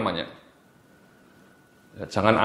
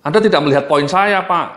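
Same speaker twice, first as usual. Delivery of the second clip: louder, higher impatient brush-off; dismissive; 6 semitones higher, 4 dB louder than usual. Shown as a demonstration. Trainer demonstrates a rude reply to a customer, louder and impatient. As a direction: push the customer's point aside. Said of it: "kata-kata yang merusak hubungan".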